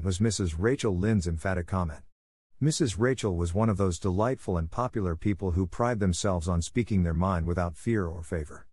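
plain reading voice

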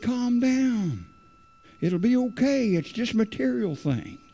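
a light take on the pastor calming him; imitating the pastor. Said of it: "He said".

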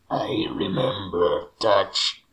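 slow, breathy demonic whisper. Pretend. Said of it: "una voz demoníaca returbia". (susurro demoníaco)

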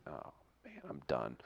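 low, soft resignation; defeated. Retold or imitated. Student after coach yells at him, defeated. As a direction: sink into it under your breath.

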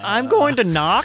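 giggling through the words; laughing. Real, not acted. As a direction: laugh as you say it. Laughing while declaring knock.

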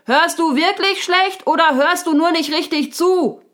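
high, loud exasperated question. A narrator's reading. Unicorn demands an answer from the deaf raccoon, very loudly. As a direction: raise the voice right through the question. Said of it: "fragte das Neinhorn laut".

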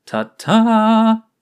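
lauter, hoher Zaubertrick-Fanfarenruf; präsentiere es wie ein Bühnenzauberer; feierlicher Ausruf. Acted. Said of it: "rief er feierlich aus". (Fiaram präsentiert Oriana seinen Blumenstrauß, laut und hoch)

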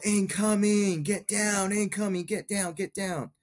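high, urgent warning yell, fading at the end; retold yell. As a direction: shout it like rounds are landing nearby. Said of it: "and I yell".

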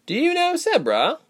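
high-pitched tentative question; hesitant girl's query; timid, unsure. A narrator's reading. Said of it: "her gaze timid and unsure".

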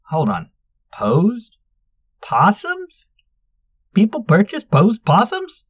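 high rising disbelief; can't believe it; incredulous. A narrator's reading. Luna incredulous at possums.

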